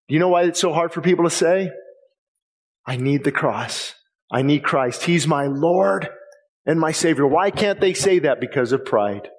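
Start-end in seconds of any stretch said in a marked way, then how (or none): 2.9-7.3 humble confession. Acted. Voicing a sinner's prayer.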